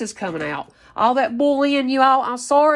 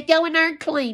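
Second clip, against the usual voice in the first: higher mock-bossy order; 6 semitones higher than usual. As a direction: mimic her giving the order in a higher voice. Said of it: "You're the type that say".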